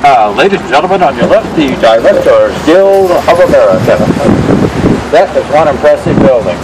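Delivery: booming awards show announcer; Academy Award announcer voice. Pretend. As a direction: announce it like Oscar night. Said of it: "I am also practicing my Academy Award announcer voice".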